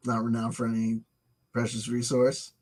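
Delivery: muttered repeat. Shown as a demonstration. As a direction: mumble it to yourself. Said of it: "muttered repeat of line".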